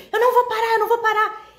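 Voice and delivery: voz bem mais aguda e alta, nervosa; agitado. Retold or imitated. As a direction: gritar em pânico que não vai parar. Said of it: "ele tava muito agitado". (motorista agitado gritando que não para)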